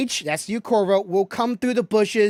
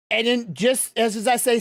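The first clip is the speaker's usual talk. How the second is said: usual speaking voice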